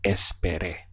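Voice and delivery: Esperé, stress on the last é; final E stressed. Shown as a demonstration. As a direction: clear demo of espeRÉ. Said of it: "stress this final accent here over the E".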